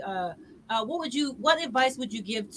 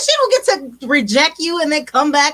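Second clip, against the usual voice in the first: outraged on his behalf, laughing; indignant; 2 semitones higher, 11 dB louder than usual. Real, not acted. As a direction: hold on, she doesn't get to. Host protesting the ex's return.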